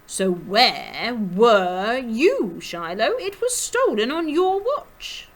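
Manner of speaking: loud, stretched-out accusation rising high; theatrical, high-pitched reproach; accusing dandy. A narrator's reading. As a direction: confront the guard in a swooping character voice. Clooney accuses Shiloh of negligence.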